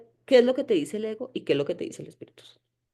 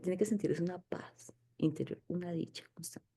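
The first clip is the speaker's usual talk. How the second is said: whispered peace; 13 dB quieter than usual. Real, not acted. Describing inner peace.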